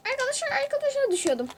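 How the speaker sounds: high, fast alarmed cry to the viewers; panicked call. Real, not acted. Streamer falling in the game, panicked high call to viewers. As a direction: blurt it out in a rush as you fall.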